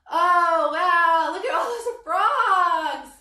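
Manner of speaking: loud, bright-eyed wonder at the frogs; excited. Pretend. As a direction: shout it out to the kids like you've spotted something amazing. Pretend frog sighting for kids.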